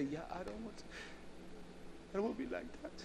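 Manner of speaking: high, very soft mock crying; acted tearful little voice; imitated weeping. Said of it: "I would just weep and cry and say".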